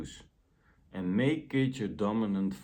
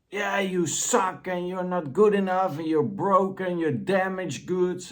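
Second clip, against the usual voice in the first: mocking inner critic, much higher and louder with a changed timbre; 9 semitones higher, 6 dB louder than usual. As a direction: sneer like a cruel inner critic, pitched high. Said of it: "that critical voice".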